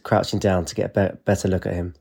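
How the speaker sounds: ordinary delivery, nothing marked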